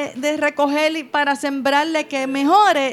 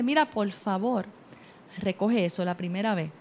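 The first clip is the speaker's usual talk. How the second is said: soft, lower, patient voice; gentle request; 6 semitones lower, 8 dB quieter than usual. Shown as a demonstration. Parent calmly asking child to pick up, soft and gentle. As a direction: ask kindly and quietly. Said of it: "si tú vienes y le dices".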